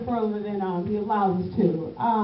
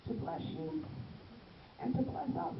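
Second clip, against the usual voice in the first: quiet first take; 13 dB quieter than usual. Shown as a demonstration. Preacher stating the two reasons softly to the church. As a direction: say it gently first. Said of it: "Let me say that again".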